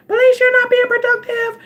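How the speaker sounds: high nagging voice scolding herself; mock her own inner nag, high. Vlogger mimics the nagging voice in her head, high and shrill.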